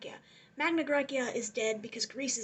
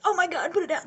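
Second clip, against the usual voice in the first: louder, higher mock cry of alarm; 4 semitones higher, 8 dB louder, faster than usual. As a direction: call out in hammed-up panic. Retold alarm at library fire.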